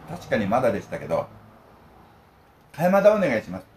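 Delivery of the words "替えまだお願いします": 替えまだ said with a clear "mada" instead of "dama", loud and high; nervous mispronunciation. Retold or imitated. Nervous Ehime researcher ordering 替えまだ, swapped syllables.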